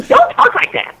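snippy. Retold or imitated. Annoyed coworker scolding two women playing characters, imitated as snippy. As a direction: imitate a snippy woman telling them off. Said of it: "she would say this snippy thing".

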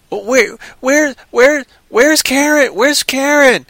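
high, halting worried questioning; mock distress. Film character searches for Karen, high mock-distressed voice. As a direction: stammer it out in hammed-up worry.

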